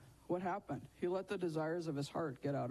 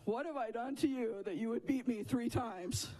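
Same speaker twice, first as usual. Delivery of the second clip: higher-pitched wronged donkey voice; aggrieved donkey; 5 semitones higher than usual. Acted. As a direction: plead like a mistreated talking donkey. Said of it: "You know what the donkey said?".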